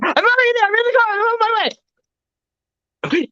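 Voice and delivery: very high, breathless eagerness; over-eager Kyle. Retold or imitated. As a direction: jump at the invite before it's finished. Eager friend Kyle answering.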